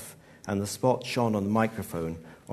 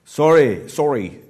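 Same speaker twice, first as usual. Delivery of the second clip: loud, higher Cockney apology; sudden Cockney accent; 6 semitones higher, 13 dB louder, slower than usual. A narrator's reading. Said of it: "his accent cockney all of a sudden".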